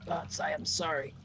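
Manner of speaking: soft, slow, hushed remorse; despairing apology. Acted. Doomed warrior apologizing to his gods, soft slow despair. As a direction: let it sink quiet and slow, in despair. Acted despairing last words before suicide.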